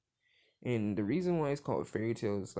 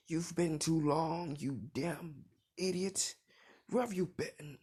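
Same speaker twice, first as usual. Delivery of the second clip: scolding Makarov; 5 semitones higher, slower than usual. Retold or imitated. Makarov grabs Igno in giant form, slow high mock scold. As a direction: drag out each word like a cranky old master.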